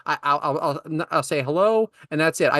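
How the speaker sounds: ordinary talk, nothing marked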